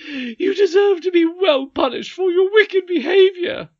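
high, shrieking nurse shout; furious scolding. Acted. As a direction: berate the child at full volume. Nurse scolding, threatening punishment.

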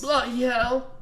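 high-pitched, put-on English exclamation; mock English accent. Comic defying criticism of his accents, exaggerated English. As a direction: squawk it in a bad English accent. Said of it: "you're not good at accents".

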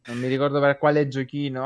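ordinary talk, nothing marked

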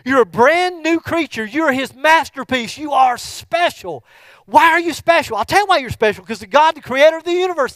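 loud, high, fervent preaching; shouted sermon climax; preaching at full force. Acted. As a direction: proclaim it to the whole church.

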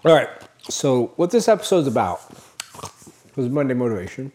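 talking while chewing ice; words round a mouthful of ice. Real, not acted. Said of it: "Sorry if I'm chewing ice".

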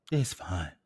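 "It's fine" whispered. Shown as a demonstration. Talking herself down about tickets, a whisper.

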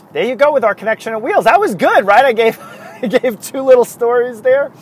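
high, loud, laughing delivery; amused. Real, not acted. Podcaster pleased with two little stories for the listeners, laughing. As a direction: let a real laugh break into the words.

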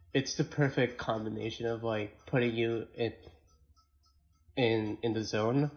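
ordinary delivery

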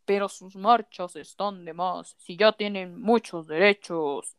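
a hint of mocking a smug critic. Mocking a typical homophobe.

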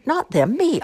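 high, loud, indignant correction; yelling back. A narrator's reading. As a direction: shout over the other girl. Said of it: "Imogene yelled back at her".

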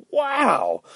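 usual speaking voice

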